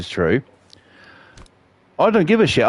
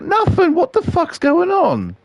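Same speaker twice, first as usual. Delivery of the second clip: baffled protest. Retold or imitated. Acted quarrel, his baffled reply.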